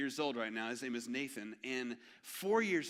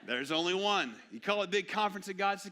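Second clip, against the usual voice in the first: louder, higher "only one" with a laugh; excited repeat; 7 semitones higher, 6 dB louder than usual. Shown as a demonstration. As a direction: punch "There's only one" with joy. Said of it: "That's how he talked".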